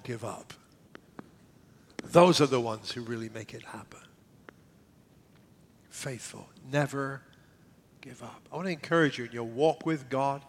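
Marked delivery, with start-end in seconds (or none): 2.9-4.0 low, intimate whisper. Real, not acted. Whispered conviction. 5.9-8.4 almost breathe it; barely audible. Real, not acted. Near-silent exhortation.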